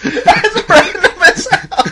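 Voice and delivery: high, loud words through laughter; cracking up while trying to talk; laughing hard. Real, not acted. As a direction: squeal it out mid-laugh. Cracking up at joke.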